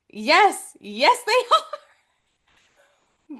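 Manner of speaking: high, gleeful yes breaking into laughter; delighted. Real, not acted. Co-host amazed the title is said in the movie, delighted. Delighted answer to own question.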